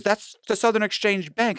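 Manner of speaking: high-pitched insistent protest; protesting agent. Retold or imitated. Agent insisting to the teller about the bank, protesting.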